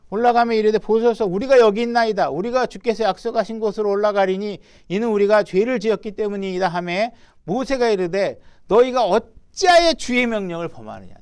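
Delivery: loud, solemn Bible reading at full voice; scripture reading. Acted. Preacher reading God's oath to the congregation, loud and solemn. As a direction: proclaim the verse like a preacher. Reads people's and Moses' words.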